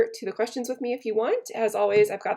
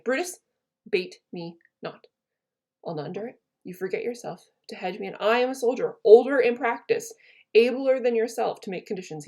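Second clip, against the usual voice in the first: dramatic Shakespeare reading. Acted. Teacher reading Cassius to her class, dramatic recital. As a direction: read it out like a wronged general.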